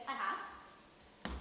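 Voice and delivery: breathy whispered reply. Acted. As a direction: whisper it shyly. Shy answer about the wedding.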